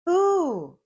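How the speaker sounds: high, loud hooting who; owl hoot. A narrator's reading. Owl calling to Blue in mild surprise. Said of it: "called an owl with mild surprise".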